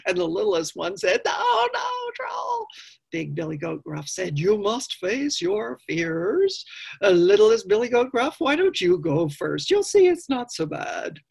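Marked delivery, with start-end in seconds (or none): 1.2-2.7 very high, tiny wailing goat; frightened little goat. Acted. Littlest goat fearing the troll, very high wail. 4.4-11.2 lightly voiced big brother goat; slow and firm, then higher coaxing; bossy big goat. Big goat sending littlest goat first, a touch bossy.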